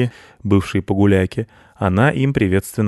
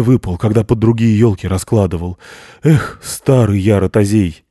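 put-on louder old man counting gifts; worried Grandfather Frost; 2 semitones higher, 4 dB louder than usual. Grandfather Frost searches the sack, louder fretting old voice. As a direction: fret over the missing present.

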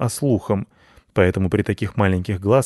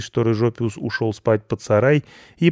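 usual speaking voice

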